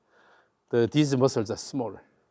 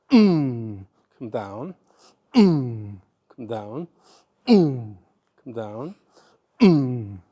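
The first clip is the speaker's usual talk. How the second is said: rhythmic effort grunts; 2 semitones lower, much slower than usual. Shown as a demonstration. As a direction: grunt the backswing, then ease down. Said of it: "So that means".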